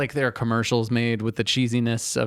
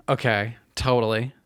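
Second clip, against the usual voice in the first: ordinary talk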